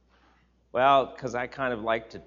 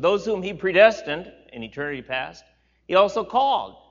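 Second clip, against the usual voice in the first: louder, raised preaching read; scripture reading; 5 semitones higher, 8 dB louder than usual. Acted. Pastor reading Jesus' words aloud to the church, raised and solemn. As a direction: proclaim the verses to the congregation. Reads Romans eight aloud.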